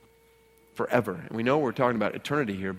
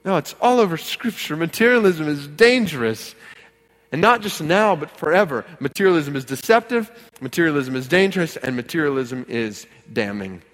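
raised, punched preaching voice; emphatic preaching; 5 semitones higher, 8 dB louder than usual. Real, not acted. Pastor warns church that materialism is dangerous, emphatic preaching. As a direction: drive each warning home to the room.

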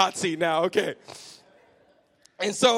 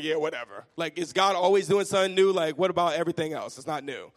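dismissive whatever; 7 semitones lower than usual. Retold or imitated. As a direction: shrug it away, unimpressed. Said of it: "And I was like".

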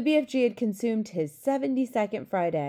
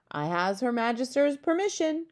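higher exclamation, climbing; gleeful cry; 6 semitones higher than usual. A narrator's reading. Giant allowed by the Queen, gleeful crying out. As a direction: rise in triumph through the phrase. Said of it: "cried the B F G".

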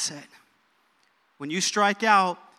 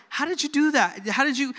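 amazed teammate; 7 semitones higher than usual. Retold or imitated. Teammates amazed at Babe's home run.

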